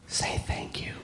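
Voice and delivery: soft whispered prompt. Retold or imitated. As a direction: whisper the cue to him. Wife prompting him at table.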